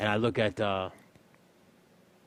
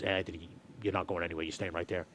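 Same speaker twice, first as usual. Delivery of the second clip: manager voice; 4 semitones lower, 8 dB quieter than usual. Retold or imitated.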